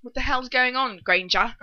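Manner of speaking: a hint of a furious Draco; mock angry Draco. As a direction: play him always angry, half-joking. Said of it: "So do angry".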